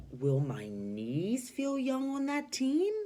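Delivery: high, slow, doubtful; uncertain. Shown as a demonstration. Teacher voicing unsure Mister Potter asking Missus Teaberry. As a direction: wonder aloud, unsure. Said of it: "He's a little uncertain".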